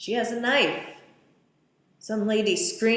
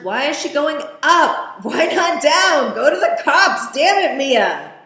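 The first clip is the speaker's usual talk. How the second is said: much higher, much louder exasperated shouting; yelling at screen; 8 semitones higher, 15 dB louder than usual. Real, not acted. Viewer shouting at Mia on screen, exasperated and loud. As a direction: yell advice at a character who can't hear.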